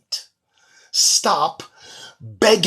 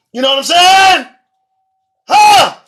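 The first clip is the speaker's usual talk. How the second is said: higher, louder rhetorical shout; shouting challenge; 10 semitones higher, 12 dB louder than usual. Real, not acted. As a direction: yell it at them, daring an answer. Ranting, demanding agreement.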